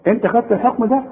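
ordinary talk, nothing marked

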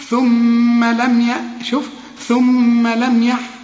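ثم lingered on with a held nasal hum, very slowly; nasal ghunna demo. Shown as a demonstration. Teacher demonstrating ghunna to a Quran student. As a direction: hum the doubled mim in ثم, slowly. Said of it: "الميم الأولى تغن".

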